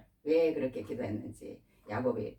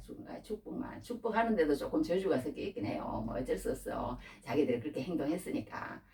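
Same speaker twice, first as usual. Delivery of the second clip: usual speaking voice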